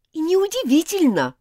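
high, loud spiteful retort; angry. Acted. Elizabeth answers Martin's plea with an angry retort. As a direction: snap it back at him. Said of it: "зло сказала Элизабет".

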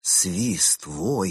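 usual speaking voice

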